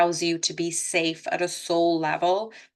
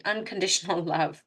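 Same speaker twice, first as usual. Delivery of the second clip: real laugh breaking into the phrase. Real, not acted. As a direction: let a small chuckle slip out mid-phrase. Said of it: "I'm only laughing 'cause unconditional love".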